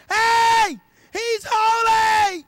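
high, loud, slow shout; angel shouting. Acted. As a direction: call out across to another angel. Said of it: "they're screaming it to each other".

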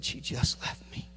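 whispered, slow heartbreak; hushed, devastated whisper; broken whisper. Retold or imitated. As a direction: barely get it out. Friend's devastated call.

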